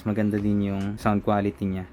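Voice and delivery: ordinary talk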